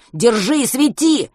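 loud urgent order; angry command. A narrator's reading. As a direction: bark the order. Commanding friend angrily.

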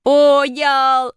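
high, loud, long-held exclamation; triumphant cry. A narrator's reading. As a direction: call it out high and stretched in delight. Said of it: "закричал Шишкин". (торжествующий протяжный крик)

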